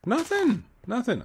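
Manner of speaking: high, sing-song innocent; feigned innocence. Pretend. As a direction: play it like you're hiding something.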